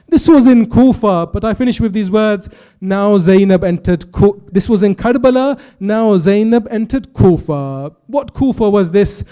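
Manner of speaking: loud, slowed climax of a majlis; preaching at full force. Acted. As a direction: drive the mourning narrative home to the hall.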